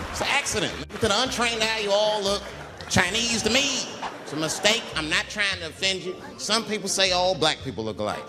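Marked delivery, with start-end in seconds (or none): none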